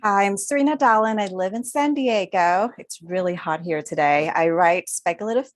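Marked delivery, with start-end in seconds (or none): none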